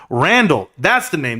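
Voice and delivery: ordinary talk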